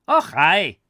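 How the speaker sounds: loud, high Scottish exclamation; hammed-up broad Scottish caretaker; broad Scottish accent. A narrator's reading. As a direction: burst out in very broad Scots. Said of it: "in a very broad accent".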